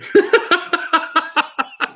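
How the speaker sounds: sudden high-pitched laugh at his own joke; squeaky laugh that breaks off. Real, not acted. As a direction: burst into a high cackle.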